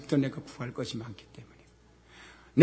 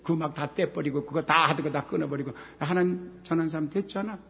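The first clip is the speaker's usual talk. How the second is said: usual speaking voice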